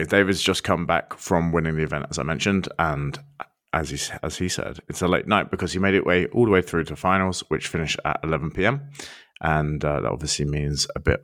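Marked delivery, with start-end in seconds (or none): none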